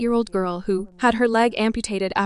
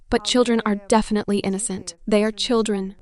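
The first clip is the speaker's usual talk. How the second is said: normal talking voice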